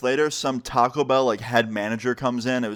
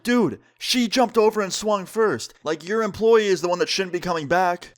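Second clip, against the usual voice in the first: exasperated, higher-voiced retold protest; mock indignant protest; 6 semitones higher than usual. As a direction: imitate arguing back, pitched well up.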